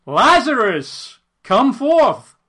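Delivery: slow, high, loud call into the tomb; commanding shout summoning the dead man; loud cry. A narrator's reading. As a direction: call Lazarus out of the grave. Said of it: "he cried with a loud voice".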